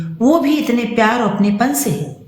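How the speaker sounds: ordinary delivery, nothing marked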